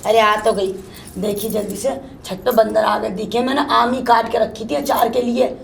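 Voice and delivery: ordinary delivery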